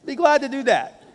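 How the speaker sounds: high-pitched mock eagerness to oblige; put-on people-pleaser voice; over-willing yes. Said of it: "I still find myself saying".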